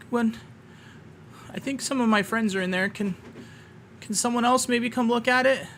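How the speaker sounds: high, quick worried kobold voice; a small creature begging others for help; pitched far up, anxious asking; pleading kobold Meepo. Acted.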